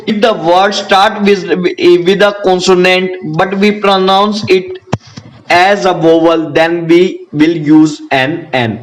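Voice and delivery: very slow, word by word; slow dictation. Shown as a demonstration. Teacher dictating the exception rule to students, very slow. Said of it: "teacher dictating exception slowly".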